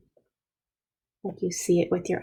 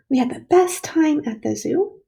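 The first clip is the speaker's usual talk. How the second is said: higher, louder, with "best" punched; excited exclamation; 7 semitones higher, 5 dB louder than usual. Shown as a demonstration. Teacher shows pupils an exclamation mark sentence, excited. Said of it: "I put an exclamation mark".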